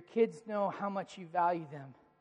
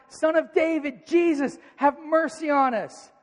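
higher, louder, insistent plea; persistent shouting; 8 semitones higher, 8 dB louder, slower than usual. Retold or imitated. Blind men keep shouting to Jesus, desperate loud plea. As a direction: keep crying out over the crowd. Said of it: "they just kept shouting".

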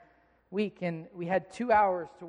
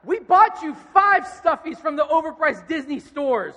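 louder and higher, half laughing through the fury; mock angry rant; 8 semitones higher, 12 dB louder than usual. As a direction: play the furious dad you wanted to be, hammed up. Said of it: "I felt such anger in me".

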